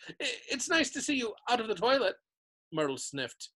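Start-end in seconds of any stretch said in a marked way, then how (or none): 0.1-2.2 high, forced pleasantness; falsely bright. A narrator's reading. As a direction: stumble into a too-cheery compliment. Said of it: "in a falsely bright voice".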